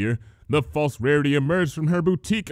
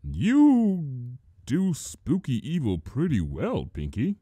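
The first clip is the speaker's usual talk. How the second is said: dubious; slower than usual. Acted. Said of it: "said Spike dubiously".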